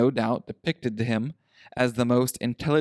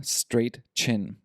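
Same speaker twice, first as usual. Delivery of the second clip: ordinary delivery, nothing marked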